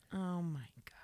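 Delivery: hushed awe whisper. Real, not acted. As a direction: whisper it in amazement. Touched reaction.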